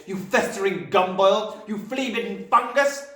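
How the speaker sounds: high, loud furious insults; shouting Trunchbull. A narrator's reading. Headmistress abusing Wilfred, loud and furious. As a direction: hurl each insult at the child. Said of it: "shouted the Trunchbull".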